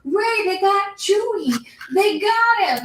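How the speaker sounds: high, hammed-up panicked cry; frantic alarm. Finn alerting Rey that Chewie is taken, hammed-up frantic cry. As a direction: play Finn's panic big and a little silly. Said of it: "he's waving his arms frantically".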